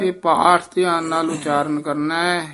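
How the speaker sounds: ordinary delivery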